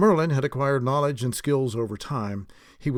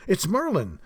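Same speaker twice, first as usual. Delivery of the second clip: high-pitched, excited announcement; excited exclamation; 7 semitones higher than usual. A narrator's reading. Someone at the door spotting the visitor, excited exclamation. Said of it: "exclaimed an excited voice".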